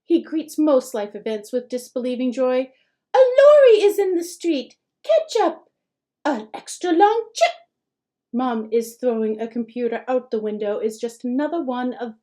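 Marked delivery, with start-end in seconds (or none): 3.1-7.5 put-on much higher, louder child voice; a four-year-old announcing wonders; excited little boy. A narrator's reading. Four-year-old listing daily miracles.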